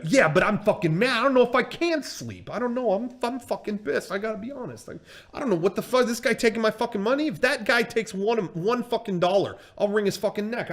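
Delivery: high, agitated angry rant; really pissed off. Retold or imitated. Boxing promoter refusing to calm down and sleep, pissed off. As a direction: imitate a worked-up man who can't settle. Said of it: "he's really pissed off".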